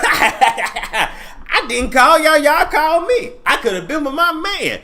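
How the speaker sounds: high, slowed, laughing Iyanla quote; Iyanla impression. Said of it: "she said".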